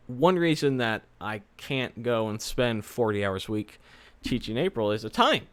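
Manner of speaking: ordinary delivery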